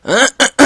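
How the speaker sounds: loud rising throat clear. Real, not acted. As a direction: clear the phlegm from your throat. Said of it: "without phlegm in my throat".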